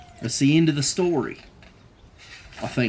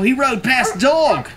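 plain reading voice

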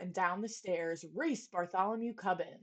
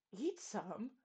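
soft shocked gasp; 9 dB quieter than usual. A narrator's reading. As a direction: breathe it in disbelief. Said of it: "gasped Bartholomew".

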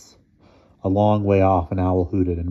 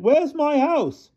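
high, slow anguished wail; sobbing cry; much slower than usual. A narrator's reading. Mister Thomas asks the driver, sobbing. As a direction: stretch the question into a wail. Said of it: "He gave a sobbing cry".

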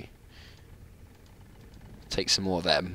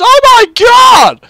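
much higher, louder, slow drawn-out shout; excited shout; 16 dB louder, much slower than usual. Real, not acted. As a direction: cry out in real delight. Frame rate jumps, gamer cries out.

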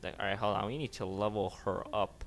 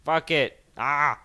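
loud, higher frustrated yell; frustrated outburst; 5 semitones higher, 10 dB louder, slower than usual. Real, not acted. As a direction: blow up at the game. Frustrated with decontamination scene.

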